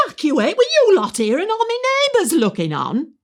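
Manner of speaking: high, loud comic outrage; scandalised disbelief. Acted. Resident mishearing the major's order, loudly scandalised. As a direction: shriek it back, utterly affronted.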